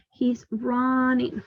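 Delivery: drawn out slowly, word by word; slow model answer. Shown as a demonstration. Teacher showing learners how to answer, very slow. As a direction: say it slowly for the class. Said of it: "Look, what is he doing?".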